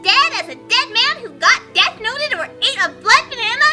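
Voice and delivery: ordinary talk, nothing marked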